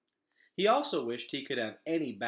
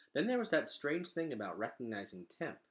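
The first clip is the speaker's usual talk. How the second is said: usual speaking voice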